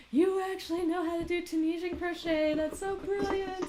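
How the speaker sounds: high, loud, sing-song amazement; a character bowled over with delight; astounded. Retold or imitated. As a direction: gush like she can't believe it. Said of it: "she is astounded".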